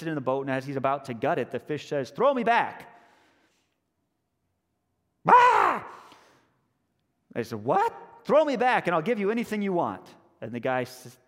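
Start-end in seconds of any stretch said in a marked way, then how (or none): 2.1-2.9 loud, higher fish's cry; urgent plea. Acted. Caught fish begs for release. 5.3-5.8 loud, high mock scream; startled scream. Fisherman startled by talking fish. 7.6-7.9 high, loud startled question; startled disbelief. Acted. Fisherman startled by talking fish. 8.2-10.1 high, loud bargaining cry; urgent plea. Acted. Fish repeats its plea, bargaining.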